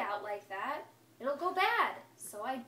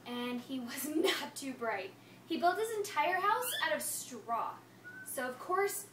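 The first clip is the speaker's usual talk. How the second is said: usual speaking voice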